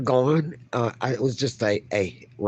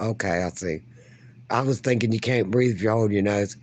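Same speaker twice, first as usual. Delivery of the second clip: ordinary delivery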